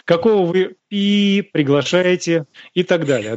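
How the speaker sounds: usual speaking voice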